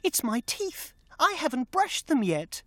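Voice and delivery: put-on little girl voice. A narrator's reading. Narrator voices little girl Merinda.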